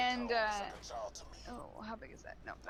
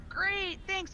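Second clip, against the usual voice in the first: high, breezy fake-ID bluff; mock-cheerful reply, pitched up; chipper bluff; 9 semitones higher, 3 dB louder than usual. Friend answering the store clerk as Scott, chipper bluff.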